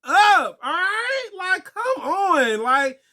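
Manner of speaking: mock outraged shout at a noisy moviegoer; fed-up outburst. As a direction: tell the talker off, voice cracking up. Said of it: "everyone's just looking at him like".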